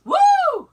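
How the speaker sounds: high, loud "woo"; loud whoop. Real, not acted. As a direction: let out a sudden high whoop. Shaking off coughing fit.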